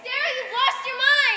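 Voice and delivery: loud, slow scolding cry; loud exclamation. Acted. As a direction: cry out in disbelief. Friend scolds.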